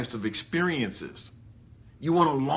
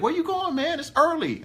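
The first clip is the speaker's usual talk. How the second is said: much higher, mock-incredulous friend; surprised friend; 13 semitones higher than usual. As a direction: play the puzzled buddy at the party, pitched way up. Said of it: "They'd be like".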